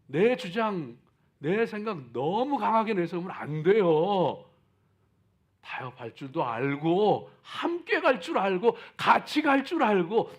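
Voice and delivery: loud, high sermon fervor; booming motivational speech. Acted. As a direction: preach it out full force. Preaching at full force.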